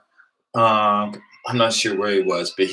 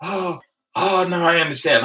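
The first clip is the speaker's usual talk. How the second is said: dawning realization; 9 semitones higher than usual. Retold or imitated. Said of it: "he was like".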